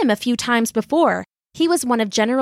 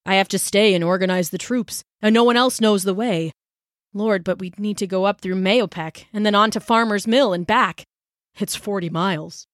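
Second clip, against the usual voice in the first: put-on a touch of a weary old soldier; lightly voiced colonel. Father weighs the long ride, lightly voiced soldier. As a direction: think it through aloud.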